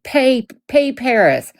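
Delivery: mock calling out. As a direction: call it out as if into someone's livestream. Imagined calling out in a live.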